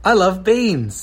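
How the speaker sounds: slow, high, loud model; loud demonstration. Shown as a demonstration. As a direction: call it out slowly for copying. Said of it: "just nice and loud".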